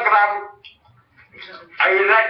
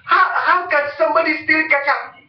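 baffled onlooker; 9 semitones higher than usual. Pretend. Bystanders reacting to a ketchup thief, shrieking in disbelief. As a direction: play a stunned bystander squealing in shock. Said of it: "People will be like".

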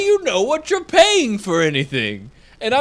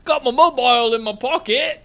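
mock British uptalk; 5 semitones higher than usual. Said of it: "Going up at the end 'cause I'm British".

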